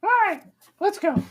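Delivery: quick, very high falsetto; squeaky call to get moving; Ford. Acted. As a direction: hurry them along in a tiny high voice. Game master plays Ford.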